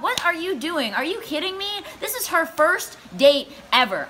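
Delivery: high-pitched, indignant telling-off; outraged rant. Acted. Storyteller berating the date who wants her to pay, indignant. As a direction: tear into him in comic outrage.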